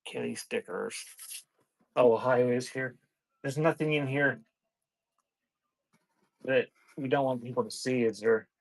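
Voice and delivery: ordinary talk, nothing marked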